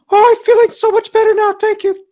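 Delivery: very high, loud falsetto; high-pitched woman's voice. Acted. [name] puppeting the wheeled-away woman to thank the gentlemen, high-pitched. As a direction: gush thanks in a squeaky falsetto. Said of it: "[name] starts going in a high-pitched voice".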